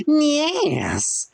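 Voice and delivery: very high strained squeal; salamander creature voice. A narrator's reading. The salamander answers Frank, squealing high.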